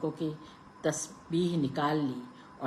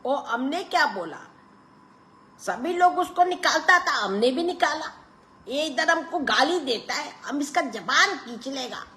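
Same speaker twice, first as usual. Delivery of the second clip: much higher, loud Pathan protest; indignant Pathan; 12 semitones higher, 5 dB louder than usual. A narrator's reading. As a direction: protest hotly. Pathan defends himself loudly.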